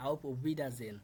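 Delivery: auf Wiedersehen said slowly and clearly, every syllable sounded; teacher slowly correcting auf Wiedersehen for a student; slow corrected pronunciation. Shown as a demonstration. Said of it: "আমি যেভাবে বলছি এভাবে বলবেন".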